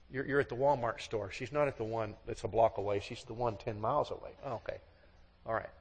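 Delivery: ordinary delivery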